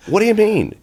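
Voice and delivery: mock surprise. Actor retold answering the director.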